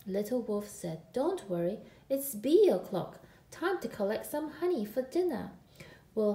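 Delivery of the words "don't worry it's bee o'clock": usual speaking voice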